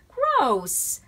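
high, loud disgusted groan; girl disgusted by the python choice. A narrator's reading. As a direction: wrinkle up at the idea. Sister reacts to python.

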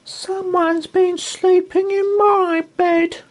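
very high, loud squeak; squeaky Baby Bear. Acted. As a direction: squeak like a tiny upset cub. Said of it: "squeaked Baby Bear".

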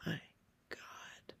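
very soft, fading to no voice; hushed, barely audible; whisper. Acted. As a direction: breathe it out in a stunned whisper. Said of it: "Ginya whispers".

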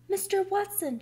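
high, urgent call; alarmed shout. A narrator's reading. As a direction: call out to your husband in alarm. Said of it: "she shouted".

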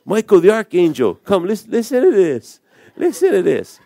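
high, eager call to come listen; excited beckoning. Retold or imitated. God calls archangel over, amused retelling.

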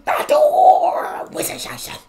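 mock German, "Natur" blown up loud and very high; exaggerated emphasis. Reactor copying the German again, wildly overdone emphasis on "Natur". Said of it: "You gotta really emphasize parts of the word".

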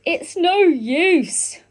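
usual speaking voice

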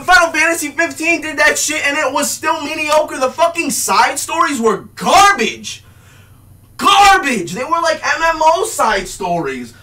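shouting about side stories; angry shout. Real, not acted. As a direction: bark it out in disgust. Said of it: "me fucking yell".

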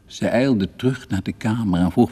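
plain reading voice

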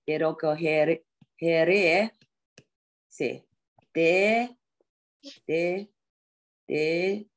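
te recogeré stretched out, then te repeated slowly; very slow drill. Shown as a demonstration. Teacher slowly repeating te while recalling a phrase. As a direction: drag each te out slowly while searching for the word. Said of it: "我来接你".

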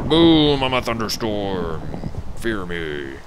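loud menacing storm voice; booming thunderstorm voice. Pretend. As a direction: boom it like a thunderstorm. Playing the thunderstorm.

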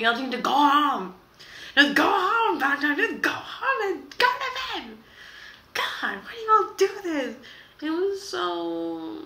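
high, loud, strained scolding of the band; mock exasperated yelling. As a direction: shout at them like they've gone too far. Yelling at BTS for the promotion.

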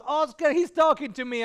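loud, high and amazed; acting out an excited cry; excited retold exclamation. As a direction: burst out with astonishing news. Retells wife's excited answer.

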